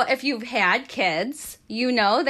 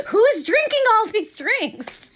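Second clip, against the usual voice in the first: exasperated squeal; 11 semitones higher, 4 dB louder than usual. Retold or imitated. Retold exasperation, voice jumps.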